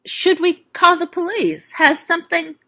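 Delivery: unsure, asking carefully; uncertain. A narrator's reading. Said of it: "said, uncertainly".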